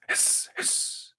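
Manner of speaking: a breathy imitated snake hiss; voiceless mock hiss. Shown as a demonstration. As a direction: hiss like a dragon. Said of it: "were to hiss".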